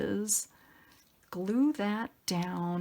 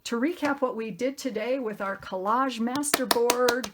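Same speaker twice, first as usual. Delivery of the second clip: usual speaking voice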